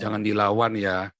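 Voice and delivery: lightly voiced father calming his child; father's gentle caution. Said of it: "ayah saya selalu bilang apa".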